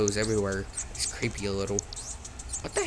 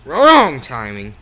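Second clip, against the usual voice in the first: loud slow groan; 13 dB louder, slower than usual. Real, not acted. Mistimed jump.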